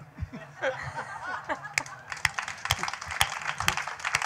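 high breathy giggle; real laugh. Real, not acted. Author laughs after reciting, real giggle. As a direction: laugh at your own silly poem.

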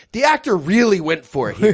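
ordinary talk, nothing marked

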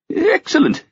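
very high, falling cry of delight; delighted exclaim. A narrator's reading. Old king delighted by the gift, crying out. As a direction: burst out with pleasure. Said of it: "nodding in delight".

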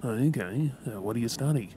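normal talking voice

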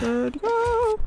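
sudden high cry; urgent yelp. Real, not acted. Fleeing the bear.

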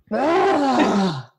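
a burst of mock outrage; exasperated yell. Reacting to God's demand, exasperated yell. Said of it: "And I was like".

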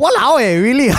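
high, loud Walao eh, really; shocked disbelief. Real, not acted. Friend hears the accent is ranked sexy, shocked disbelief. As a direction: squeal it out in total disbelief. Surprised reaction to accent news.

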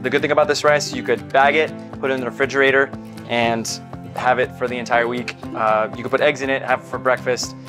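normal talking voice

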